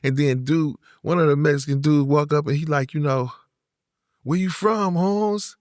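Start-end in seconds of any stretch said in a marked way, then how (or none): 4.2-5.6 imitating an LA Chicano inmate's challenge; LA Chicano accent. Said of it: "Mexicans in the Bay don't talk like that".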